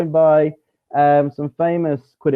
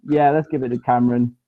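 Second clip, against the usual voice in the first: ordinary talk, nothing marked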